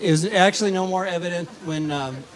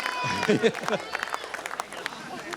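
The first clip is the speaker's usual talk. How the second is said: silent laugh; 5 semitones higher, 4 dB quieter than usual. Real, not acted. Laughing after punchline.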